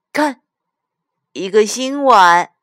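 high, loud proud presentation; excited child. A narrator's reading. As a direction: show it off to mum like a little girl. Child proudly shows new bowl. (小女孩得意地高声展示)

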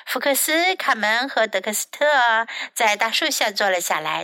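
plain reading voice

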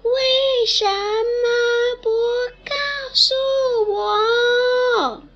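high slow echoing wail; canyon echo. Acted. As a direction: stretch it out like a mountain echo. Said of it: "大山也说".

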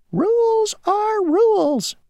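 very high, slow, stubborn tiny voice; stubborn mosquito. Acted. Mosquito refusing to bend the rule for Grasshopper, slow high insistence. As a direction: drag it out like a stubborn little insect.

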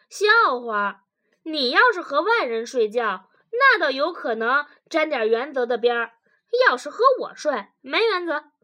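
loud, dismissive comeback; scoffing. Acted. Husband dismisses his wife's principle, scoffing. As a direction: scoff loudly at the argument.

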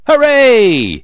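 loud, high cheer. Acted. Everybody cheers the new school roof, loud and high. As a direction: throw your arms up and cheer it. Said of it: "Everybody cheers".

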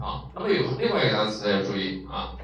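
ordinary delivery

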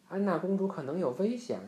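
understated shout of alarm; urgent call. A narrator's reading. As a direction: call out a warning, story-reader style. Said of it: "汉斯喊道".

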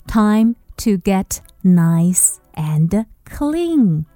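slow, gentle story voice; slow and coaxing. Acted. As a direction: draw it out gently.

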